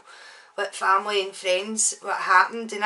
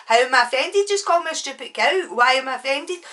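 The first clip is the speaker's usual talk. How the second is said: raised, indignant echo of his question; offended retort; 5 semitones higher, 6 dB louder than usual. Retold or imitated. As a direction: act out being affronted. Acted offended reply in quarrel.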